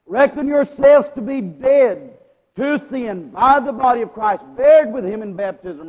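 high, loud scripture proclamation; preaching. Real, not acted. Preacher repeating Paul's command to congregation, forceful preaching. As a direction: ring the verse out over the congregation.

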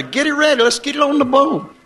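high, urging imitation of the ladies; mock eager call. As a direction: pitch it up like eager church ladies rallying. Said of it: "You ladies said".

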